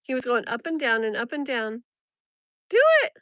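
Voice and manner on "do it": high, loud cheer; excited shout. Real, not acted. Cheering the lizard on with an excited shout. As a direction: yell at the lizard to do it.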